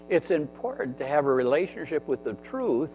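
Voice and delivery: normal talking voice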